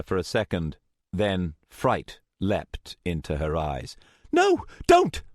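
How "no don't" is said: high, loud cry of protest; desperate high-pitched shout; panicked plea. A narrator's reading. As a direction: beg them to stop, loud and high. Plea in a tense confrontation.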